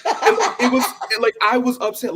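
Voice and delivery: high, loud laughing through the words. Real, not acted. Recalling her mask-rule frustration to the host, laughing. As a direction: laugh it off while saying it.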